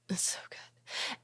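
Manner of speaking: breathy whispered aside. Real, not acted. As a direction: whisper it suggestively. Confiding about sex.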